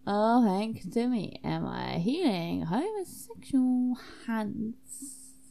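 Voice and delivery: slow, drawn-out boasting; slow, theatrical pride; grand self-congratulation. Acted. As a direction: savour every word, stretching HANDS. Claims credit for the cure.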